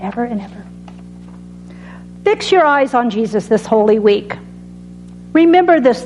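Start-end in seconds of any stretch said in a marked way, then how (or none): 0.0-0.6 fading. Acted. Closing a sermon line.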